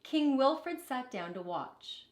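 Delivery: usual speaking voice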